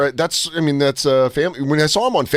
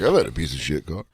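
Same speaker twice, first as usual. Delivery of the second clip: much lower, softer gruff dad voice; imitating dad; 10 semitones lower, 6 dB quieter than usual. Drunk dad quoting a comedy bit. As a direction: rumble it low like a drunk dad. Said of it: "my dad's like".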